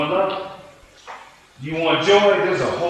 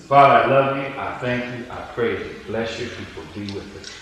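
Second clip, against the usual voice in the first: closing prayer; 4 semitones lower than usual. Acted.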